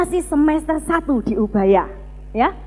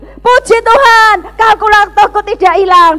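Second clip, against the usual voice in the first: loud, high cry of relief; exultant praise; 6 semitones higher, 8 dB louder than usual. Retold or imitated. Student who found the calculator, exultant shout. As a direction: shout thanks to God in relief. Acting out relieved diary entry.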